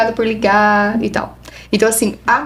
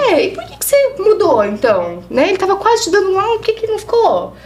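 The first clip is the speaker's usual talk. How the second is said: higher, probing tone; pointed questioning; 8 semitones higher than usual. Retold or imitated. Psychologist questioning the client, high probing voice. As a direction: challenge the client, pitched up.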